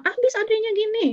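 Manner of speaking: put-on a kid blaming her little sister; whiny child. Older niece whining to her aunt about her sister, high-pitched.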